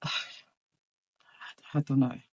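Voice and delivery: slow, soft sighing near-whisper; defeated sigh. Real, not acted. Remembering her deflated dad, a real sighing whisper. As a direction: let it trail off in a breathy sigh.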